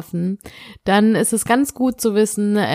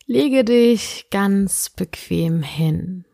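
sleep meditation; slower than usual. Acted. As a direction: guide the listener gently into sleep.

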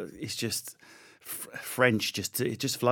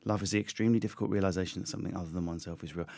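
fast, softer, lower run-through; quick repeat; 4 semitones lower, 8 dB quieter, faster than usual. Real, not acted. Guest repeating his quote, fast and low. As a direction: rattle the quote off quietly.

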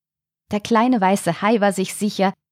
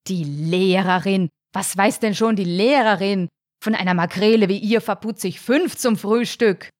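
dismissive, indignant retort; scornful father shark. A narrator's reading. Father shark dismisses the teacher's opinion, scornful. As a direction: scoff at the very idea.

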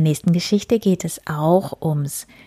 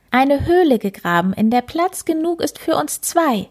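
proud; 8 semitones higher than usual. Acted. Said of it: "antwortete der Hase stolz".

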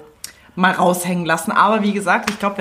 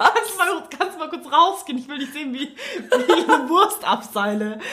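embarrassed plea; 7 semitones higher than usual. Acted. Acted plea in glass bathroom.

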